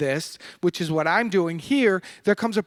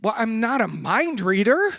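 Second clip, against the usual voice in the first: ordinary delivery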